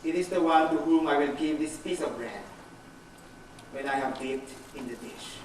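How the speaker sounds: low, hushed pronouncement; solemn quoted Master. Acted. The Lord names his betrayer at table, solemn and low.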